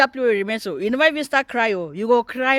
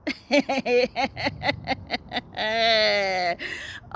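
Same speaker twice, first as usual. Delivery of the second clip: laughing till crying; 3 dB quieter than usual. Real, not acted. Laughing at the clip.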